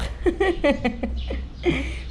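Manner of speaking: laugh. Real, not acted. Laughing at crying story.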